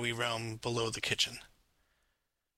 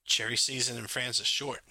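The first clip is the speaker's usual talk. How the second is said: usual speaking voice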